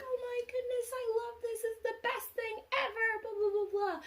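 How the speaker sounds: very high, squeaky mock happy-cry; overjoyed little girl. Little girl crying happily over the bears, high-pitched mock joy. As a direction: imitate a child overcome with joy. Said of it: "crying because she was so happy".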